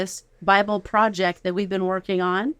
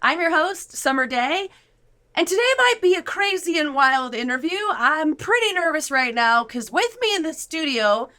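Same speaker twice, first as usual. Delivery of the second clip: enthusiastic host intro; 6 semitones higher than usual. Acted. Host opens podcast episode.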